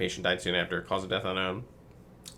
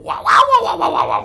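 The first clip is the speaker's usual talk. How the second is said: loud, much higher hammed-up wails; mock wailing; 13 dB louder than usual. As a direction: overdo the agony for laughs.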